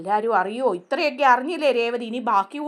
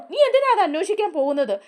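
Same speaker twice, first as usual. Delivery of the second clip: high, loud angry demand; angry question; 7 semitones higher, 4 dB louder, faster than usual. Retold or imitated. Chandra scolding Revathi for prying, angry and high. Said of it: "Chandra ദേഷ്യപ്പെടും".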